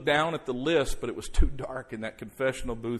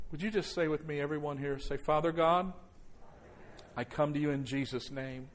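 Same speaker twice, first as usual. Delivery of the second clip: fervent guided prayer; leading prayer; 2 semitones higher than usual. Acted. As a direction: lead them in prayer, line by line. Leads congregation in prayer.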